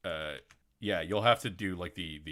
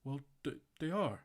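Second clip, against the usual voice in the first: high put-on voice explaining; mock sheepish reply; 9 semitones higher than usual. GM voicing a sheepish giant from Gallant in a high voice. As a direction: shrug it off in a high voice. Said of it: "It's like".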